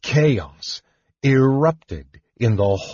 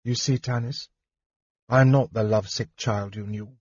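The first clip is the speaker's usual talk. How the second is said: softly spoken, understated sadness; sad smile; 3 dB quieter than usual. A narrator's reading. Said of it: "Lorana smiled sadly".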